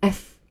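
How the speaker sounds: 轻声连读F; blended F. Shown as a demonstration. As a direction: join eh and a light f into "F". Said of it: "连在一起念一下".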